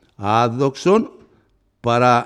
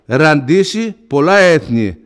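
loud, high sermon climax; impassioned proclamation, loud and high; full-force preaching; 6 semitones higher, 7 dB louder than usual. Acted. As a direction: preach it out to the whole church.

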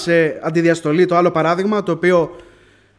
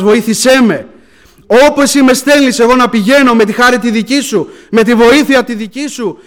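loud, pleading pulpit prayer; raised, impassioned praying voice; fervent preached prayer; 5 semitones higher, 8 dB louder than usual. Real, not acted. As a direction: cry out to God before the congregation.

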